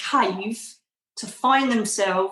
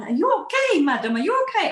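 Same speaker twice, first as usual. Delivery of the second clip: much higher voice for the concerned driver; worried cab driver; 9 semitones higher, faster than usual. Retold or imitated. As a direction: play the anxious taxi driver checking on her.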